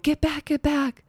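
high, sharp command to step back; shielding drunk kids from the attacker, calling high; urgent warning call. Retold or imitated. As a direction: push them back with your voice. Pushing kids away from attacker.

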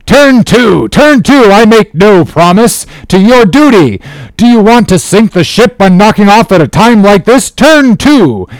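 high, forceful captain's orders; commanding shout. A narrator's reading. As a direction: bellow orders at defiant sailors. Captain orders mutineers back to work.

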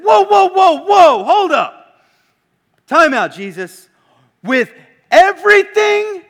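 high, loud incredulous protest; alarmed objection. Retold or imitated. Scribe protesting to Jesus, loud and incredulous. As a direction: throw up the brakes in disbelief. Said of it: "this guy should have said".

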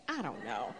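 high bursting laugh at herself; giggling at the Gucci bag. Real, not acted. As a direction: laugh off the confession. Laughs at own Gucci search.

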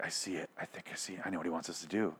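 very soft, low imitation of a hushed revelation; hushed realization. Reviewer imitating doctor's vision from God, hushed. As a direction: quote him under your breath.

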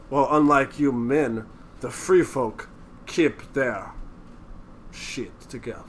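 slow, low Italian-accented impression; soft, drawn-out words in a rough Italian accent; mock Italian accent. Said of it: "he's got a fucking Italian accent".

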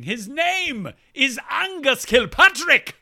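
loud, high, drawn-out name reveal; grand announcement. Acted. Host introducing a new correspondent with a great name, grand announcement. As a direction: announce it big and slowly, voice soaring.

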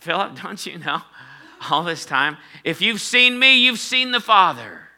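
high-pitched, indignant retort building to a loud finish; disgusted tone. Retold or imitated. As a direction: act out a fed-up teacher scolding a follower. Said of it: "kind of in a disgusted tone".

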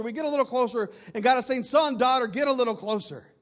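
loud, high preaching voice; impassioned preaching. Real, not acted. Preacher urging congregation to get closer to God, impassioned. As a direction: urge them onward with full force.

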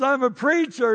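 high, proud proclamation; retold excited announcement. Preacher revealing his job to the fan, excitedly.

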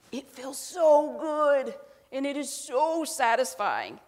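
high, loud, drawn-out satisfaction; gleeful relish. Acted. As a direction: savour it, stretching each "so". Relishing her imagined comeback.

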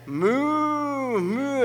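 high, playful moo to mime beef; miming a cow for the waitress; mock cow moo. Mooing to show beef.

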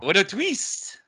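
high, drawn-out mock plot-twist cry; M. Night Shyamalan. Joking about his Twilight Zone twist, mock-dramatic. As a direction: exclaim it as a hammed-up big reveal. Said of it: "Night Shyamalan".

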